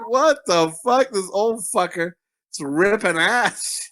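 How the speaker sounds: slow, drawn-out mock disbelief; high, slow incredulous reaction; retold bewilderment. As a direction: stretch it out in baffled amazement.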